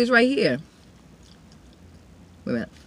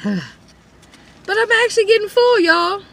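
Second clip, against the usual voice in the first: laughing; 11 semitones higher, slower than usual. Real, not acted. Laughing while eating.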